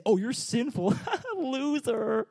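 hammed-up taunting laugh; mock jeering Jesus. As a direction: jeer at the sinner with a laugh. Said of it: "He also doesn't look at you and say".

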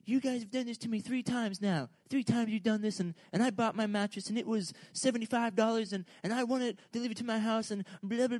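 lightly imitating an angry caller; a hammed-up hint of a mad lady on the phone; mimicking a mad customer. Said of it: "just super mad".

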